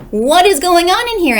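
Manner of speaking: high, loud sharp question; demanding. Acted. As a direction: burst into the room demanding answers. Eugenia bursts in demanding.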